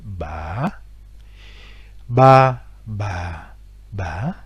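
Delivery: ba, ba, ba, ba qui montent, descendent et remontent; répéter ba au ton interrogatif; question tone repeats. Shown as a demonstration. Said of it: "l'accent interrogatif". (ba répété en accent interrogatif)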